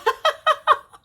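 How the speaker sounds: very high, loud burst of laughter; real helpless laugh at herself; shrieking laugh. Real, not acted. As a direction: let a squealing laugh burst out. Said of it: "Now I'm laughing".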